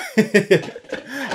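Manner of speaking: loud amused laugh; real laugh; high burst of laughter. Real, not acted. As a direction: crack up at your own joke. Laughs after mock PSA.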